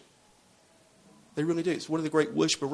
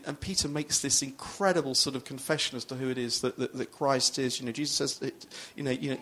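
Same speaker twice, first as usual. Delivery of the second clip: ordinary delivery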